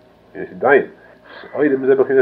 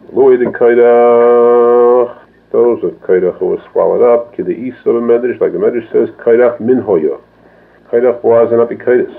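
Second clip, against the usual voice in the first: louder, driving sermon delivery; fervent preaching; 6 dB louder than usual. Acted. Rabbi preaching on Korach to his students, loud and fervent. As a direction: preach it out with full force.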